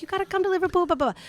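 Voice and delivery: high, badly faked English accent; terrible English accent. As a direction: imitate eager Brits with a poor accent. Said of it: "I'm terrible at Eng-, English accent".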